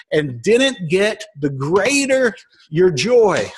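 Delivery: earnest sermon delivery rising in pitch; preaching. Acted. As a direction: press the lesson home to the congregation, slowing at the end. Preaching the point to listeners.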